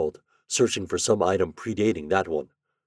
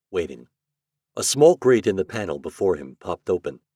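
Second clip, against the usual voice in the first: plain reading voice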